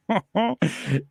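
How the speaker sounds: short laugh at his own joke; laughter. Real, not acted. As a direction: burst out laughing at the bit.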